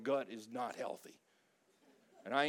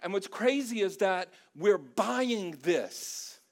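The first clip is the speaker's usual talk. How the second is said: full-force pulpit preaching, much louder; fired-up preaching; 6 semitones higher, 7 dB louder than usual. Acted. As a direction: preach it hard against the magazine. Preaches against the magazine at full force.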